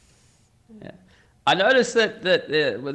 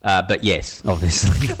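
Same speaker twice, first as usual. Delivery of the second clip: ordinary delivery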